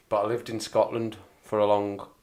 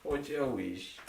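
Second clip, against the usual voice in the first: soft Scottish; 4 semitones higher, 8 dB quieter, slower than usual. Shown as a demonstration. Repeat of Scottish phrase.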